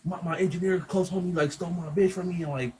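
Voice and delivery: high-pitched, wounded complaint; upset friend. Retold or imitated. Teller voicing his client's grievance over the stolen girlfriend, high aggrieved imitation. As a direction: imitate a betrayed man venting, pitched well up.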